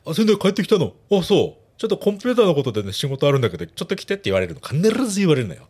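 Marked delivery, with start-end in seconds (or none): 0.1-4.1 club manager. Retold or imitated. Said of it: "店長がね".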